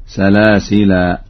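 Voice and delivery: سلاسلا ending in a long "aa", no n; stop on alif. Shown as a demonstration. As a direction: slowly say "salasilaa" for سلاسلا. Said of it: "وقف عليه بالألف هكذا". (سلاسلا بالوقف على الألف)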